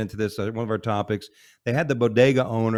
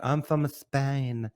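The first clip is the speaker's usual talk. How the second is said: slow, put-on Spanish accent, softer; mocking Spanish accent, lispy and slow; 4 semitones higher, 3 dB quieter, much slower than usual. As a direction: parody a fake Spanish accent, drawn out. Mocking the fake Spanish wife.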